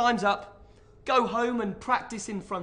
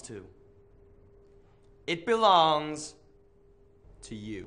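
lower, grander presidential delivery; presidential speech; 3 semitones lower, 3 dB louder than usual. Shown as a demonstration. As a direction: speak up like a president. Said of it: "You must learn the way he speaks".

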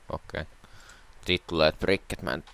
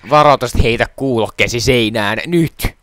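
loud, raised-voice public warning; mock warning announcement; 4 semitones higher, 12 dB louder than usual. Player voices warning for video viewers, loud mock alert. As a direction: blare it out as an urgent alert. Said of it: "Pitää laittaa semmonen niinku varoitus".

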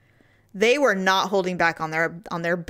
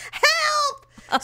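much higher yelped "Help"; mock cry for help. Retelling the feared air conditioning, mock panic. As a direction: cry out like you're being blown away. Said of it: "We're like".

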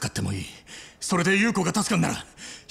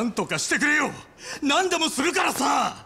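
desperate plea; 7 semitones higher than usual. Acted. Desperate brother begs doctor.

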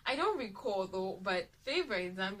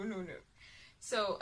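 normal talking voice

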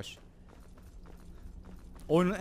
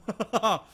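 short laugh; 5 semitones higher, 5 dB louder than usual. Real, not acted. Laughing after the shout.

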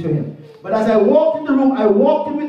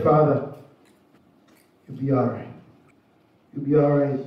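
soft, low, gentle comfort at a bedside; tender reassurance; 8 semitones lower, 5 dB quieter, faster than usual. Retold or imitated. Preacher comforting his dying father-in-law, soft and tender. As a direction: soothe a dying man quietly.

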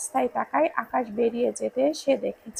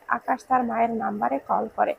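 usual speaking voice

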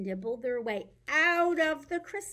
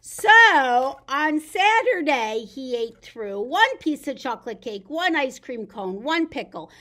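lively picture-book reading; reading to children; 3 semitones higher, 9 dB louder, slower than usual. Acted. Teacher reads The Very Hungry Caterpillar to kids. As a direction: read it big for little ones.

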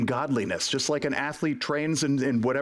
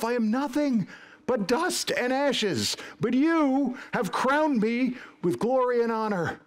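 high-pitched, building declaration to God; exultant prayer; 9 semitones higher than usual. Acted. Believer standing before God, exultant. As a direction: cry out in awed, joyful confession.

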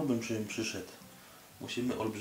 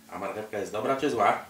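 normal talking voice

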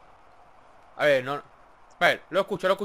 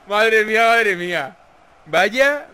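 amazed; 6 dB louder, slower than usual. Real, not acted. Reacting to carnage.

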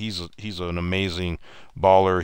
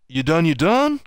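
high, loud mocking question, shooting up at the end; cocky rising taunt; Michael, taunting; 9 semitones higher, 7 dB louder than usual. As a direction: imitate a star player needling his beaten rivals. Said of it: "this is Michael talking".

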